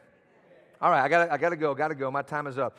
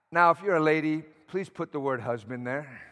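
usual speaking voice

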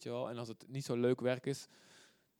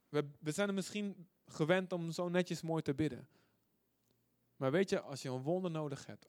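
usual speaking voice

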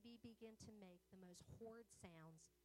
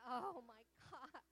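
panicked thought; 5 semitones higher, 7 dB louder than usual. Retold or imitated. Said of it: "And I thought".

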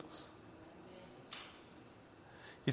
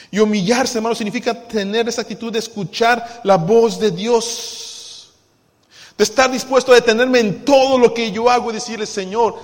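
loud, ringing preaching voice; fervent preaching; 7 semitones higher, 12 dB louder than usual. Acted. Pastor exhorting the church to humble itself, loud and fervent. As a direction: drive the point home to the congregation.

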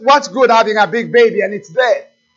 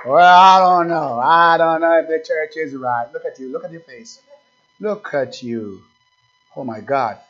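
mocking doubters; 5 semitones lower than usual. Cold churchgoer doubting the church is right, mocking low mumble. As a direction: grumble it low, fading to a near-mumble.

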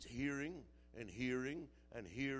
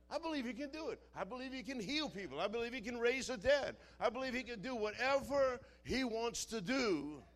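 high-pitched declaration of faith, building louder; fervent, raised pulpit voice; impassioned preaching; 8 semitones higher, 5 dB louder than usual. Real, not acted. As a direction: preach it with rising force.

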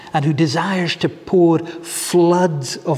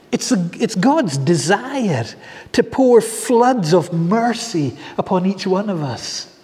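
ordinary talk, nothing marked

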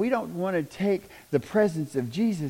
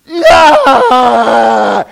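loud, high mock scream; mock screaming protester; 8 semitones higher, 16 dB louder than usual. Imitating a woman screaming over the president, hammed-up yell. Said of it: "crying and screaming because Trump was president".

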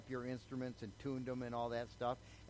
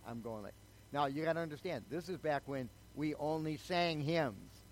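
ordinary delivery

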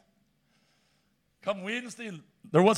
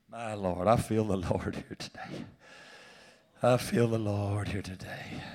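low, soft, lingering repeat; reverent; 9 semitones lower, 10 dB quieter than usual. Real, not acted. Preacher sensing the Lord in the room, low and soft. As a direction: say it twice, hushed and reverent.

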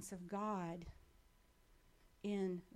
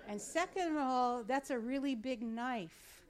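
louder, higher, slowed-down alarm; emphatic alarm; 4 semitones higher, 6 dB louder than usual. Retold or imitated. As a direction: stress the worry about the knife. Alarmed thought about the knife.

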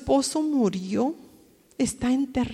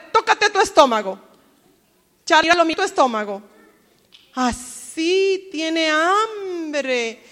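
usual speaking voice

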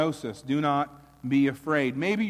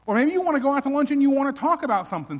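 excited; 10 semitones higher, 5 dB louder, much faster than usual. Real, not acted. Pleading, rising excitement in sermon.

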